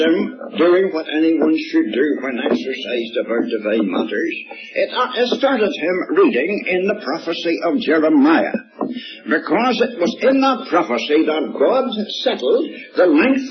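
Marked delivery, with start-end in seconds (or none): none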